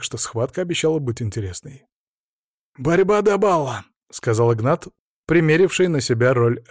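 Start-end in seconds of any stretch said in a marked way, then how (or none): 2.8-3.8 high-pitched referee's call; referee's announcement. Acted. Игнат to the fighters as referee, announcing.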